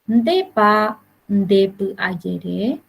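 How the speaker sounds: slow, careful question tune; teacher spelling it out slowly; slow question model. Shown as a demonstration. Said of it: "vuelvo a repetir".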